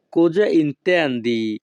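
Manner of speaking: each Italian word spaced out very slowly; slow teaching model. Shown as a demonstration. Teacher models Italian phrase for students, very slow. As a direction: spell it out slowly for learners to copy. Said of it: "Italian ভাষা".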